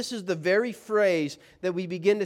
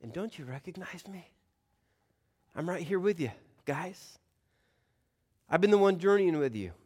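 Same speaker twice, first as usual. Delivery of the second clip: hushed whisper, close and tender; intimate Jesus; 3 dB quieter than usual. Retold or imitated. Jesus speaks softly to his disciples, whispered. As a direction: whisper like a friend at your side. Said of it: "I think Jesus is saying".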